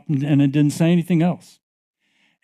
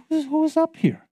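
much higher, softer wondering call; puzzled call out; 12 semitones higher, 4 dB quieter than usual. Retold or imitated. Preacher voicing her puzzled call again, high and soft. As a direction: ask the empty room, high and hushed.

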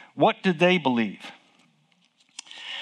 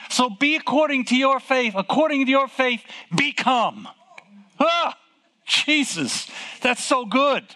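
higher-pitched preaching excitement; thrilled; 6 semitones higher than usual. Real, not acted. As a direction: revel in the discovery, voice lifted. Preacher thrilled by his discovery.

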